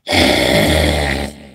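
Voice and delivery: low, loud growl; imitation animal growl, low and loud. Shown as a demonstration. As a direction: growl like a beast.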